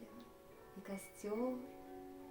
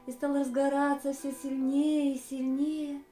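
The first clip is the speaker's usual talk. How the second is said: louder narration, building with the flames; swelling excitement; 3 semitones higher, 8 dB louder than usual. A narrator's reading. Storyteller describing the campfire flaring up, swelling excitement. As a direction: let the repeat swell.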